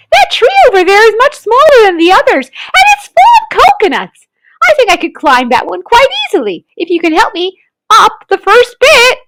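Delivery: put-on high, quick child voice; bright little boy pointing out a tree; eager child Toto. A narrator's reading. Toto to Mary, planning the climb: high eager child. Toto's voice.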